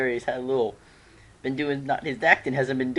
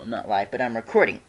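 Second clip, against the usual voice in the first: ordinary delivery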